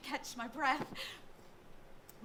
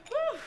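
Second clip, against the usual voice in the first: high, loud whistle of relief; a big breath out after holding it in; relieved whew; 14 semitones higher, 7 dB louder than usual. Acted. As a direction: blow it all out in relief. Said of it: "That finally felt good. I took a breath".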